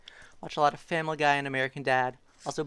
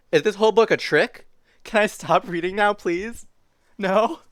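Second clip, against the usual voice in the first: higher-pitched storybook protest; exasperated pleading; 8 semitones higher, 7 dB louder than usual. Acted. Book's reluctant reader asks to stop, pleading disbelief. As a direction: beg to be let off the book.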